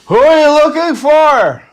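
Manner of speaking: put-on high, loud drunken holler; drunk man calling out. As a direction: imitate a drunk shouting from the ground. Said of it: "a drunk guy who's, like, on the ground".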